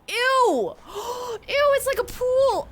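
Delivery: high, loud grossed-out squeal; disgusted shriek. Real, not acted. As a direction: recoil from something gross. Disgust at the filthy yard.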